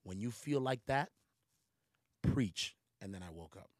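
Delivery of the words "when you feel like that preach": louder, rising to a firm push on preach; God commanding the dreamer. Retold or imitated. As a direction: command with authority. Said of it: "where he said".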